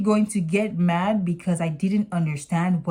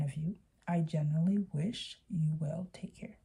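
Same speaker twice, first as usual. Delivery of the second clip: whispered sign-off; 3 semitones lower, 10 dB quieter than usual. Retold or imitated. Reading her own final message.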